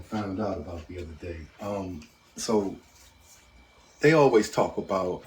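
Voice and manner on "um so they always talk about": normal talking voice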